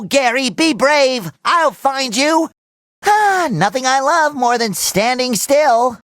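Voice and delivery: ordinary talk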